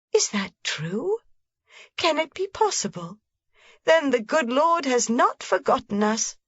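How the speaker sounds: a touch of wondering relief; can't quite believe it; amazed. A narrator's reading. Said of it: "the old woman cried".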